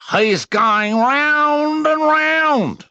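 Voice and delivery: very high, loud, slow wonder; much impressed. A narrator's reading. Roo watching Eeyore spin, impressed. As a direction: marvel at it. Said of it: "much impressed".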